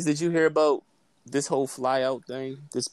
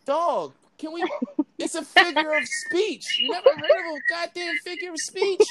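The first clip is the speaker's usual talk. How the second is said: much higher, exasperated mock retort; 13 semitones higher than usual. Host mocking his literal co-host, higher exasperated retort. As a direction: play yourself fed up with her. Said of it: "And I'd be like".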